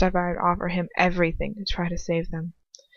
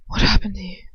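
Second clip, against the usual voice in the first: gentle whisper; 3 dB louder than usual. Acted. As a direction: breathe the question gently. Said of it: "His voice gently touching".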